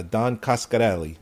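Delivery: Italian-accented name with a rolled sound in Coscarelli; Italian inflection. Shown as a demonstration. Co-host showing the name Coscarelli on request, Italian inflection. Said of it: "I can give it that in-, inflection".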